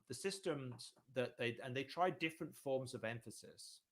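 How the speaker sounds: usual speaking voice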